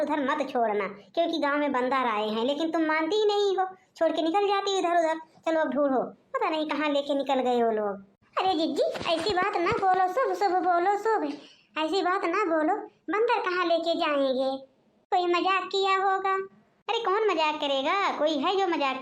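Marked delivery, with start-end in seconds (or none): none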